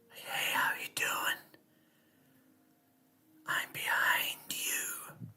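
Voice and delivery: slow, breathy Michael whisper from the shadows; creepy whisper. Pretend. Vlogger imagines Michael talking to a victim, slow creepy whisper. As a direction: hiss it slowly like a stalker right behind you. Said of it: "like, a whispering voice".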